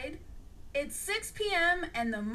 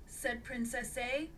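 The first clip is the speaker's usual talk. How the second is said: ordinary delivery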